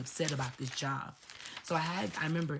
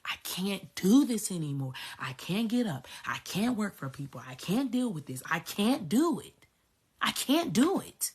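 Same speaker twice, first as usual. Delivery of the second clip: re-enacted wail, building higher and louder; crying meltdown; 5 semitones higher, 5 dB louder than usual. Alone in the park after work, mock crying despair. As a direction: act out breaking down, slowing on the last repeats. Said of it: "and I just was crying".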